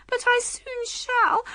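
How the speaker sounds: very high, slow distressed wail; between screams. A narrator's reading. Queen expecting to be hurt soon, distressed. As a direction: build towards a scream, high-pitched. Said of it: "in between the Queen's screams".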